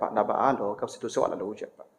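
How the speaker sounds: soft, low and gentle; small soft voice. Shown as a demonstration. Wife gently asks husband in a small soft voice. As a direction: ask him softly, without pushing.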